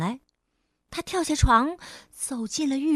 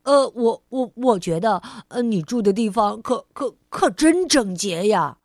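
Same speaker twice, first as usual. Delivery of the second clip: bumbling stammer; 2 semitones lower, 6 dB louder than usual. Acted. Clumsy cousin admiring the house.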